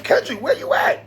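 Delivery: high-pitched mock impression. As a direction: pitch it way up, imitating a rapper calling someone out. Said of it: "In my [name] voice".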